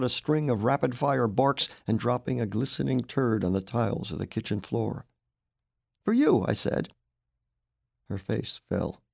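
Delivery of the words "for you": triumphant offer. Acted. Roy presents the pet.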